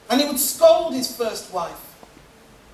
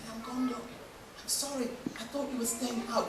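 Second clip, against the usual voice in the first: soft apology; 13 dB quieter than usual. Acted. Wife opens the door and apologizes softly.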